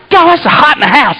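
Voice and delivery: high, fed-up moan; exasperated complaint. Retold or imitated. Sweating man grumbling about the house, exasperated.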